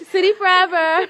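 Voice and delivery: loud, high laughing school cheer. Real, not acted. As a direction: shout it proudly through laughter. Cheering her old school.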